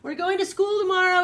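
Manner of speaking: high, loud eager farmer; thrilled news for the chickens; excited announcement. Acted. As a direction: call it out to the chickens. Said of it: "The chickens were so excited".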